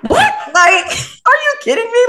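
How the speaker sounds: high-pitched, laughing disbelief; half-laughing mock shock; incredulous. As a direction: react in retold outrage, voice shooting up. Retold reaction to being called devil.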